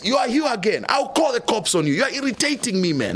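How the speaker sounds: high, exasperated imitation of the boss; irritated boss. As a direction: play the boss fed up with him, voice up high. Boss angry at him again.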